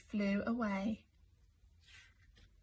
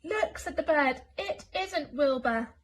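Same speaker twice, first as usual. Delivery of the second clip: urgent pointing; 5 semitones higher, 4 dB louder than usual. Acted. Bird points at flying Elmer.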